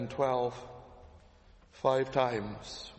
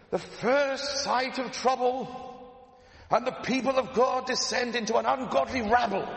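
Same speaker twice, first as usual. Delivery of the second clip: put-on Paisley accent shout; 9 semitones higher, 4 dB louder than usual. Preacher imitates Paisley shouting at the flock, Northern Irish accent. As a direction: thunder it like Ian Paisley in Ulster tones. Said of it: "he's shouting".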